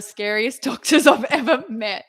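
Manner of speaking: real laugh breaking into the words. Real, not acted. Describing the scariest doctor she's ever met, laughing. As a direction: laugh through the admission.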